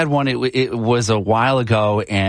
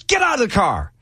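mock angry cop, much higher; angry cop order; 11 semitones higher than usual. Cop orders the driver out, imitated angry and high. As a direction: bark it like a cop having a bad day. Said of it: "he was so angry".

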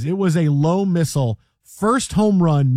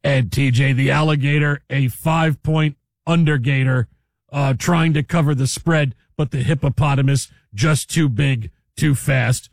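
lightly lowered, hammed-up broadcaster call; mock play-by-play; 5 semitones lower than usual. Joking to cohost about animal videos, mock sportscaster call. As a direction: call the hippo fight like a game.

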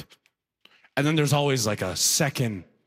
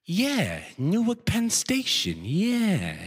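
higher, stretched-out clueless echo; senile older guy; 6 semitones higher, much slower than usual. Acted. Older guy ad-libs after his friend, senile and drawn-out. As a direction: chime in slowly like a confused old sidekick. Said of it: "Then the second older guy goes".

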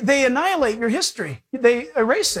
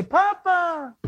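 high, drawn-out mild cry; 7 semitones higher than usual. Acted. Believer crying to God as Papa, mild high cry. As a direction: call out to a father, stretching it. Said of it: "the Holy Spirit cries out".

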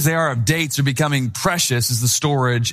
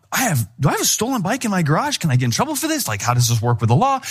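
anxious worrying; 4 semitones higher, faster than usual. Retold or imitated. Lying awake worrying.

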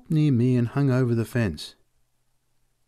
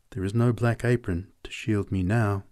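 ordinary delivery, nothing marked

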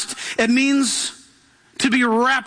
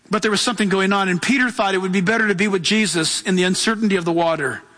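usual speaking voice

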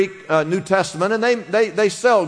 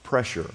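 Pressure dropped low and soft; soft emphasis; 6 semitones lower, 7 dB quieter than usual. Shown as a demonstration. Pastor stressing the meaning to the congregation, soft emphasis. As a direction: let Pressure sink quietly. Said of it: "definition of that word is pressure".

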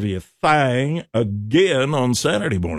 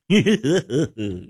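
chuckling; 3 semitones higher than usual. Real, not acted. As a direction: laugh at your own countdown. Laughs after the countdown.

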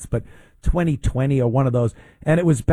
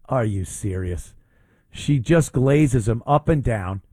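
normal talking voice

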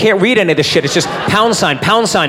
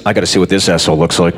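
fast mutter; 11 semitones lower, much faster than usual. Retold or imitated. His own inner thought, quoted.

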